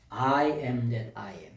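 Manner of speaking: slow, low and solemn quotation; slow solemn quote. Real, not acted. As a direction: weigh every word of I am that I am.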